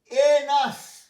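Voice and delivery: এনাস loud and high; teacher pronouncing. Shown as a demonstration. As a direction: announce এনাস clearly. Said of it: "teacher drills word". (জোরে স্পষ্ট উচ্চারণ)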